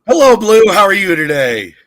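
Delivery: normal talking voice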